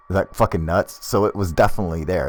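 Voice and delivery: ordinary delivery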